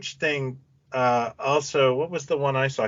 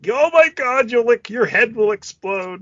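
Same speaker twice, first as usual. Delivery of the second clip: mock gushing hype; 11 semitones higher, 3 dB louder than usual. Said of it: "everyone had just, like".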